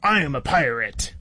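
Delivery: a touch of proud pirate bluster; pirate Backbeard. Acted. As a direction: declare it as a storybook pirate. Voices pirate in children's book.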